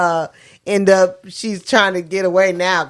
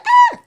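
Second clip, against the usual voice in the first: imitated shriek. She shrieks at the rat.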